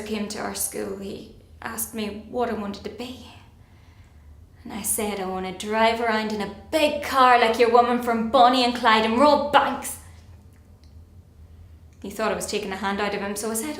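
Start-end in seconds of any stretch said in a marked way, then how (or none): 5.2-10.0 loud, emphatic announcement, slowing at the end; bold declaration. Acted. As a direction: proclaim the outrageous ambition loudly. Retelling her defiant answer to careers officer.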